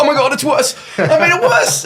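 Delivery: high, loud mock panic, cracking up; laughing panic. Host retelling his on-air blunder, shrieking and laughing. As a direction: squeal it through laughter, horrified at yourself. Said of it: "And then I'm like".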